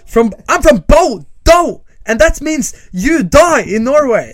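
high, loud, slow English yell; over-the-top hometown war cry; hammed-up threat. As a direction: bellow a mock death threat. Mock threat, answering the Newcastle shout.

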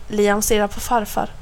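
usual speaking voice

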